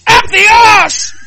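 long, drawn-out high shout; excited shriek. Acted. Friend answering in a comic script, hammed-up shriek.

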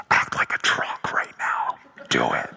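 breathy, hushed whisper of a mock divine voice; mock holy whisper. Pastor mocking the idea of God's still small voice, hushed whisper.

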